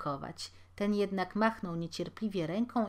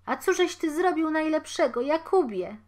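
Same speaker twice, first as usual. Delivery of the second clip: high, loud anguished cry; horrified cry; 9 semitones higher, 8 dB louder than usual. Acted. Wife finding her husband hanged, high horrified cry. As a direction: cry out in horror. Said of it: "zawołała nagle kobieta".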